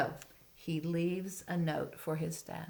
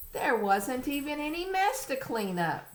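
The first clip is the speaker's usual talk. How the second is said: higher, quick storybook line; reading to a toddler; 8 semitones higher, 4 dB louder than usual. Acted. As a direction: read it brightly to a child. Reading picture book aloud.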